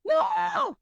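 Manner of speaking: very high mock scream; acted anguished cry. Woman reenacting the pain of her breakdown, high mock scream. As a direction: let out a high, hammed-up wail.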